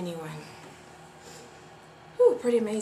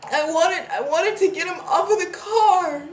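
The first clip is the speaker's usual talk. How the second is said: loud, high, distraught voice; distraught, still half crying; tearful confession; 8 semitones higher, 9 dB louder than usual. Acted. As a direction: push on through the tears.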